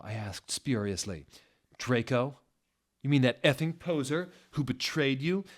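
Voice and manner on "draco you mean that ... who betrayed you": mild sneer, a touch higher; snarky anger. A narrator's reading. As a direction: scoff at the traitor. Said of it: "snarkled with anger in his sexy voice".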